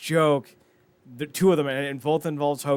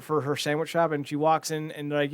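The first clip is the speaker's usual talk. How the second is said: ordinary talk, nothing marked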